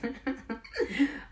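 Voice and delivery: soft, high giggle; amused laugh. Real, not acted. Comic recalling her greasy hair, amused giggle. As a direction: giggle at your own story.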